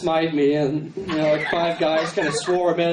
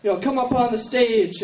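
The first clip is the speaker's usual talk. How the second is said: preacher calling up; 7 semitones higher than usual. Retold or imitated. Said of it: "He's like".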